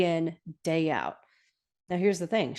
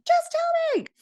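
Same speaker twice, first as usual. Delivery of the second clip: squealed, sky-high mock plea; hammed-up shriek of impatience; insistent begging. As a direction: pester at the very top of your pitch. Retelling Lorelai's escalating pestering.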